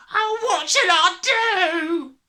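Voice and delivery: long, high, loud wail. A narrator's reading. Father despairing over his hair, wailing. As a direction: wail it out very slowly. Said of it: "wailed the father".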